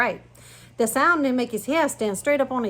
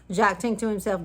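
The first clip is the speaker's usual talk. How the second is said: plain reading voice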